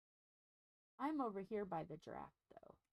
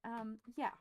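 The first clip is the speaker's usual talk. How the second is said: ordinary talk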